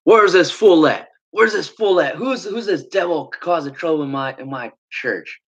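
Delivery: high-pitched mimicked indignant demand; retold angry rant. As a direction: imitate a fuming church leader hunting a troublemaker. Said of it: "huffing and puffing. He's saying".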